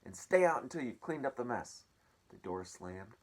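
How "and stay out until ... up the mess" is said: high, loud order; yelling aunts. Acted. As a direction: keep yelling the command. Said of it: "they yelled".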